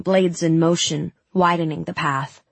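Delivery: usual speaking voice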